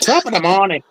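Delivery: mock Irish accent, lightly done; a stage Irish greeting, hammed up. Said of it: "my Irish accent's not very good".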